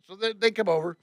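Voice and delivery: ordinary delivery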